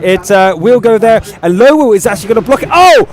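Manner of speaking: excited, driving match commentary; live esports play-by-play. Real, not acted. Caster calling the shots to viewers, excited play-by-play. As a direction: call the action as it happens, urgently.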